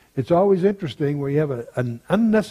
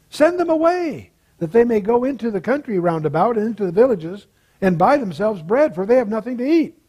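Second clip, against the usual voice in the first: scripture reading; 4 semitones higher than usual. Acted. Teacher reading the feeding passage to the class, lifted scripture reading. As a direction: read it with rising pitch on the disciples' words.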